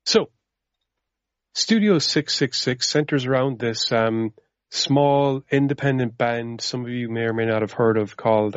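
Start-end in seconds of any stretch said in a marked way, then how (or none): none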